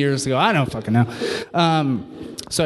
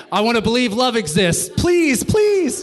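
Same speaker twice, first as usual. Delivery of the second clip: climbing to a high, desperate plea; beg, each please higher; hammed-up desperate begging; mock pleading; 4 semitones higher than usual. Acted plea to believe in love.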